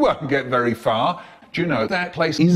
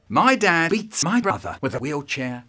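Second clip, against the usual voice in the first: usual speaking voice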